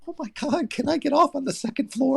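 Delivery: high, frantic plea, breaking into a laugh; a hammed-up trapped man begging to escape; mock panic. Trapped in the elevator with the owner's daughter, a high mock panic.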